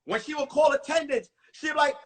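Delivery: ordinary talk, nothing marked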